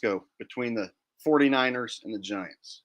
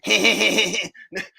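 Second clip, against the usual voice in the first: short laugh; 6 semitones higher, 5 dB louder than usual. Real, not acted. Laughing at own impression.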